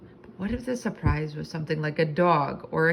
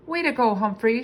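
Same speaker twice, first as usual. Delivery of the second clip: 1 cheering; 6 semitones higher, 5 dB louder than usual. A narrator's reading. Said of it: "she clapped her hands".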